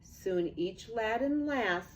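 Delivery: usual speaking voice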